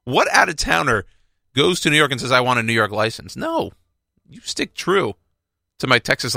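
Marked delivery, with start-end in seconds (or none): 0.0-3.4 high, squeaky disbelieving question, pitch climbing on who; incredulous squeal. Retold or imitated. Answering people who ask why no New York license, in a high incredulous squeal. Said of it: "I'm like". 3.4-5.1 indignant no. Retold or imitated. Emphatic refusal after the rant.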